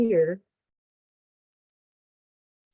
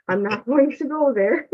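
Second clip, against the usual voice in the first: laughing it off; 5 semitones higher than usual. Real, not acted. Laughs off near tears.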